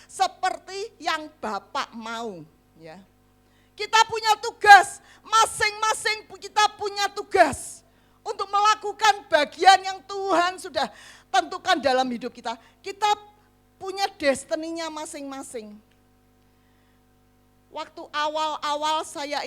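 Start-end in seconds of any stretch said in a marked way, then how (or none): 3.8-15.7 louder, higher sermon call; fervent preacher's proclamation; preaching at full force. Acted. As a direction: declare it like a pulpit cry. Preaching about each person's task.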